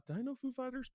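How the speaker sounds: suddenly unsure, ask yourself high; a quick surprised doubt; surprised self-question. Real, not acted. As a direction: pitch jumps way up on the question. Wondering aloud mid-list.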